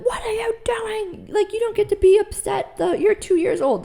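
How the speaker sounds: starts as a high whispered hiss, then a high sharp scold; hammed-up harsh parent; mock scolding. Parent scolding an upset two-year-old, high mock harshness. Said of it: "were like".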